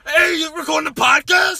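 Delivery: loud, high screamed question; Tony Brothers voice. Acted. Asking his brother about the podcast, screamy yell. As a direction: yell humor at full blast. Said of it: "That's our Tony Brothers voice".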